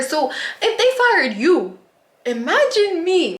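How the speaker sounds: high, drawn-out exclamation; high-pitched comic alarm; incredulous. Real, not acted. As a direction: swoop way up in disbelief. Exclaims at supervisor's firing.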